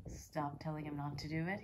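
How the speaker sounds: normal talking voice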